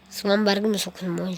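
normal talking voice

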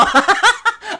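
high shrieking laugh, loud; high cackle. Real, not acted. Vlogger cracking up at her own tattoo joke, high shrieking laugh.

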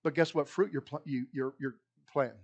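usual speaking voice

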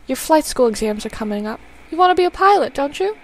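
normal talking voice

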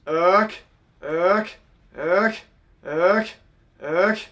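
short honks, each clipped off by the tongue; honk with T sound. Shown as a demonstration. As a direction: drop the tongue, then snap it back up to break each honk. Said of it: "we'll do the T sound".

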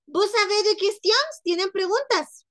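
high, loud bright question to the class; calling out to class. Acted. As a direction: call it out to the whole room. (pregunta en voz alta y aguda a la clase)

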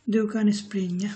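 Du kan springa drawn out slowly; slow it down so they can repeat; slow model sentence. Shown as a demonstration. Teacher models the example slowly for viewers. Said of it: "teacher models Swedish sentence".

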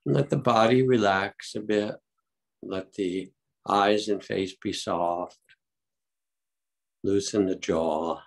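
unhurried calm meditation guide; guided meditation. Acted. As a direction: ease the group gently into stillness.

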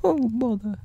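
high, mildly bothered bear; Pooh. Acted. Bear rebuffed at the hole, high bothered voice. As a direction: let out a small high-voiced complaint. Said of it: "said Pooh".

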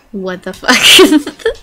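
loud, slow, stunned exclamation; shocked. Real, not acted. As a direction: blurt it out in disbelief. Sudden reaction to the effect.

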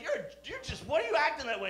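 high exasperated asking; annoyed high complaint; exasperated question. Retold or imitated. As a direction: demand why he acts that way. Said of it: "you're like".

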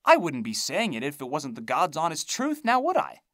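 agitated. A narrator's reading. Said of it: "getting a little agitated now".

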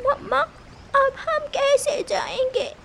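a tiny one asking anxiously; worried little chick. A narrator's reading. Chick asks mother how they'll go, worried.

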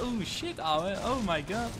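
usual speaking voice

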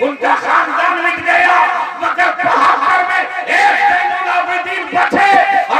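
loud, high oratory building in force; impassioned sermon delivery; fiery preaching. Acted. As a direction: thunder it out like a pulpit speech. Karbala oration.

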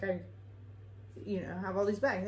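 ordinary delivery

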